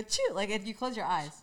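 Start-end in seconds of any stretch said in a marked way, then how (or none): 0.0-0.3 squeaky achoo, very high; high squeaky sneeze. Shown as a demonstration. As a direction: fake a tiny high sneeze. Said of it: "You go".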